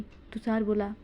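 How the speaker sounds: ordinary delivery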